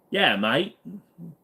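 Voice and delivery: a light Aussie twang on the greeting; Aussie accent. Acted. Streamer switching to an Aussie voice for chat, light Aussie accent. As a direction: slip into a hint of Aussie, mate. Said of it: "gonna talk like this for a while".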